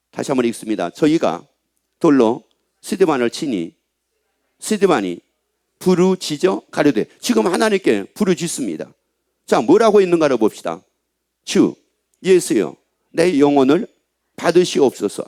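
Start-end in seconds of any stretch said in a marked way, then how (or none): 0.9-7.1 lower, measured Bible reading; scripture reading. Acted. Reads scripture aloud. 11.4-15.2 lower, solemn reading of the prayer; scripture reading. Acted. Reads Stephen's prayer.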